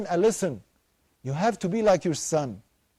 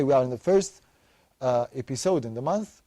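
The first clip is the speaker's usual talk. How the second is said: ordinary talk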